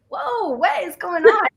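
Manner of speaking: high, startled whoa. Retold or imitated. Her younger self startled by the therapist, high mock surprise. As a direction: caught off guard, half-joking. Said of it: "And I was like".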